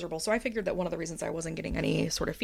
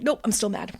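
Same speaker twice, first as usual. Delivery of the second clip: still mad; 3 semitones higher, 6 dB louder than usual. Retold or imitated. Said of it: "And then I was like".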